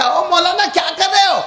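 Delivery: high shouted call across a crowd. Retold or imitated. Villagers calling out to the Maulana, high shout. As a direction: yell the question up at him.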